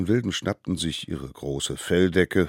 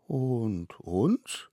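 put-on higher, rising child question; timid little ones; 5 semitones higher than usual. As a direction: ask hopefully in a small voice. Children ask about themselves. (kindlich hohe, bange Frage)

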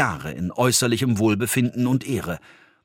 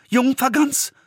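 surprised; 14 semitones higher than usual. A narrator's reading. Dwarf surprised at talking goose.

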